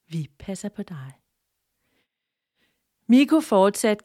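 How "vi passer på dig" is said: soft whisper; hushed farewell from the tree. A narrator's reading. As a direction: breathe the promise. Tree's parting words.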